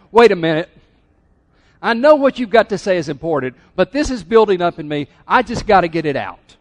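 loud acted interruption; frustrated outburst. Martha interrupting Jesus' teaching, in total frustration. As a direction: burst in and let it out, frustrated. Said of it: "in total frustration".

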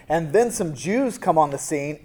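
usual speaking voice

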